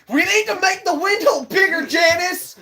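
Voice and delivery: high, loud laughing call; high-pitched laughing shout; mock shout to coworker. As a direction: yell across the kitchen to Janice.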